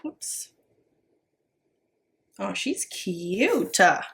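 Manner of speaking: ordinary talk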